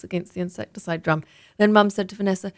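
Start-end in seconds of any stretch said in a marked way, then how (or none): none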